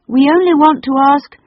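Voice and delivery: put-on loud, high rushed child; eager interruption. A narrator's reading. Robert tries to explain to the lady, loud eager rush. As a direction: blurt it before she cuts in.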